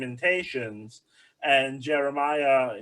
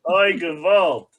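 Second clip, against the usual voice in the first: louder, comic oy vey groan; mock Yiddish lament; 5 semitones higher, 7 dB louder, much slower than usual. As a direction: ham up a Yiddish kvetch. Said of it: "Oy vey".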